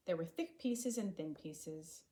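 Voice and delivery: ordinary delivery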